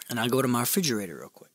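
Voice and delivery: ordinary talk, nothing marked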